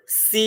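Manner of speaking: "C" with a long, drawn-out ee. Shown as a demonstration. Teacher showing the big ई in an example word, long ee. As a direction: stretch the vowel in "C" slowly. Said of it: "उसमें बड़ा ई आ रहा है".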